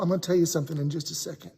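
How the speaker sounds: ordinary delivery, nothing marked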